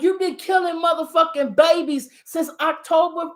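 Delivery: ordinary delivery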